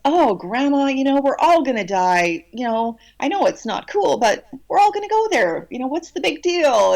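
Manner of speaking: high, bright teasing to cheer her up; playful granddaughter brushing death aside; joking reassurance. Retold or imitated. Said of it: "I just joked with her".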